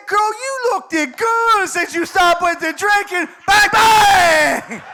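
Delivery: high, loud catcall building to a shout; acted holler. Roommate shouting a come-on to Cheryl, high holler. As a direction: holler it way up high, explode at the end.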